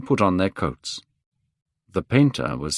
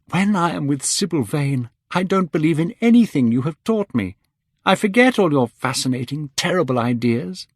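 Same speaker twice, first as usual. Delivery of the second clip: put-on higher, playful young man; light, higher mocking youth; young Dorian, teasing; 8 semitones higher, 4 dB louder than usual. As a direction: tease his mentor with delight. Dorian teases Henry.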